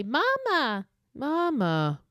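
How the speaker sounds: high, eerie, otherworldly mama; a warped ghostly child's call; creepy doll call. Retold or imitated. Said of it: "it, like, dies and distorts".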